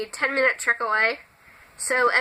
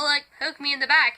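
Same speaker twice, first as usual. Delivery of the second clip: ordinary talk, nothing marked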